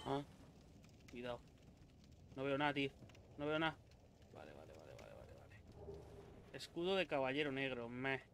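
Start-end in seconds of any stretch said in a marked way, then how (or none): 4.3-6.6 tense hushed whisper, slowing. Real, not acted. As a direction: breathe it out under tension.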